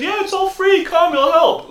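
very high, put-on sales pitch; hammed-up high-pitched coaxing; mock vaccine pitch. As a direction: squeak out a mocking come-and-get-it offer. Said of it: "It's gonna be like the vaccines".

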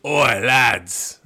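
loud mock pirate-ish Cockney; piratey British accent. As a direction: bellow it like a hammy pirate. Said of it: "That was almost piratey".

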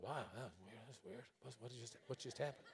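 very soft, bewildered musing; soft dazed wonder; dazed whisper. Retold or imitated. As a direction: mutter it to yourself, baffled. Dazed inner thought.

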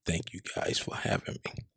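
soft, low, whispered late-night voice; midnight voice. Acted. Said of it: "I'm about to give y'all a midnight voice".

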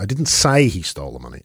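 "say" punched out mid-sentence; stress on SAY. Shown as a demonstration. Host shows how emphasis changes meaning, stress on "say". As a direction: lean on "say", other words passed over lightly. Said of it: "where you put the emphasis in that sentence".

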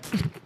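quick mock burst of air; imitated stifled laugh. Girl next to recruit cracking up, imitated puff. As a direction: imitate a suppressed snort of laughter. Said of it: "then she went".

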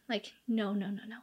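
hushed, breathy string of no's; whispered no. Retold or imitated. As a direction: drop to a whisper and shake it off. Friend rejecting his box spring claim.

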